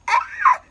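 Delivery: high loud exclamation; delighted ah. Real, not acted. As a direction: gasp at how pretty you felt. Mock self-admiration.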